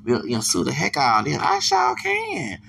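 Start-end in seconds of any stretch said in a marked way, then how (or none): 1.4-2.6 drawn-out, very high slow sing-song; mock falsetto "I sure can", slow and drawn out; high sing-song gloat. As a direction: croon it like a smug little song. Gloating about suing.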